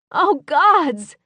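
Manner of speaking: high, loud cry of alarm. A narrator's reading. Narrator sees his bandage and cries out in alarm. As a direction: blurt it out, shocked. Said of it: "I cried".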